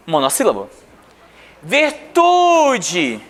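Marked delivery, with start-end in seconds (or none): 1.6-3.2 "Virtude" with "tu" held long and high; raised, lengthened "tu" in "Virtude"; stress on middle syllable. Shown as a demonstration. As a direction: stretch the "tu" in "Virtude". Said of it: "paroxítono".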